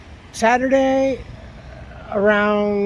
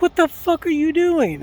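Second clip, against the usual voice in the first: raised, high, furious yell at the door; angry shout at the woman ringing his doorbell; 10 semitones higher, 4 dB louder than usual. Retold or imitated. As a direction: bark it at the stranger.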